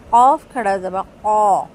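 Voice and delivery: usual speaking voice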